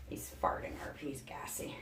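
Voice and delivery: breathy whispered aside, voice dropped right off. Real, not acted. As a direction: let it slip out as a half-secret whisper. Aside about snake passing gas.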